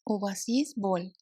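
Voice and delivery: usual speaking voice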